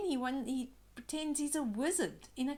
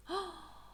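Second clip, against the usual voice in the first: gasp; 8 semitones higher, 4 dB quieter than usual. A narrator's reading. Surprised by monster.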